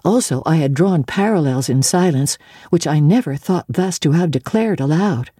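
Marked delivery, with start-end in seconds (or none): none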